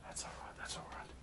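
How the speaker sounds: gentle whispered reassurance; soft, voiceless comfort. Real, not acted. Soothing himself while painting.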